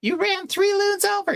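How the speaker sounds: very high squeaky mock accusation; shrill it like a cartoon; boater lady. Lady accusing the jet skier again, very high mock. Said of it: "but she's like".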